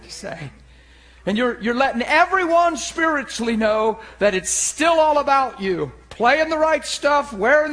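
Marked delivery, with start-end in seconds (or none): none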